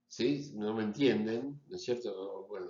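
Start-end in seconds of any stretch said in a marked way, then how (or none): none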